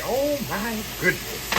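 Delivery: slow, drawn-out Chef Pam exclamation; lightly done Chef Pam catchphrase, stretched slow; imitating Chef Pam. Quoting Chef Pam to viewers, drawn out slowly. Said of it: "As Chef Pam would say".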